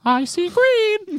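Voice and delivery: very high, slow squeak; squeaky cartoon mouse. Cohost joining the cartoon mouse bit, high and slow. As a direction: stretch it out in a tiny mouse voice.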